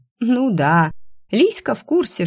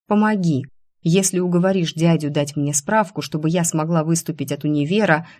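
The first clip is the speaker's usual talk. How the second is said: ordinary delivery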